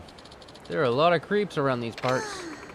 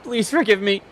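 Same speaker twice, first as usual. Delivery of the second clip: high, loud pained begging; hammed-up groaning apology; groaning plea; 10 semitones higher, 6 dB louder than usual. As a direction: whimper it as the beaten thug. Beaten thug begs.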